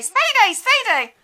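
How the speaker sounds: high, loud jeering call; mock heckle. Mocking the heckler who calls her Speedy, loud jeer. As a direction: mimic the street heckler. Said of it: "heckle mimicked again".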